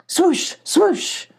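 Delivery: high, falling swoosh; sudden high whoosh sweeping down; swooping whoosh. A narrator's reading. Narrator imitates swooping attack.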